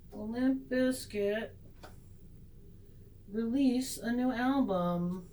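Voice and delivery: slow, measured tarot question; mock-serious retake. Shown as a demonstration. Reader asking the cards a silly question with slow mock solemnity. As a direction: draw it out slowly, with hushed mock gravity. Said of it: "Let's do that again".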